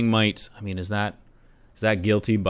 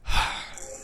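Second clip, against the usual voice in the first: soft unvoiced sigh of defeat; weary sigh; 5 dB quieter than usual. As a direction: let out a tired breath. Officer taunted, gives up.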